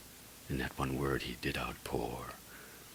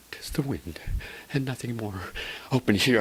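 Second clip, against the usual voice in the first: higher, nervy self-assurance; mild excitement; 9 semitones higher, 7 dB louder than usual. Acted. Narrator bracing to open the shutter, nervy. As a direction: talk yourself brave.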